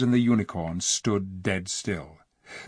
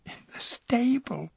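put-on higher girl's voice, slow, stifling a giggle; gasping, holding back laughter. A narrator's reading. Jill back from the stable to Eustace, suppressed laughter. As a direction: gasp it out, fighting a laugh. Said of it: "gasped Jill".

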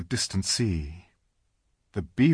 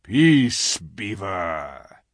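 low growl; 5 dB louder, much slower than usual. Acted. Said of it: "with a very low growl".

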